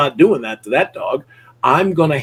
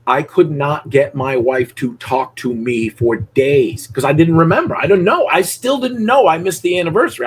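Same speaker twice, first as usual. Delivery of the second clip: normal talking voice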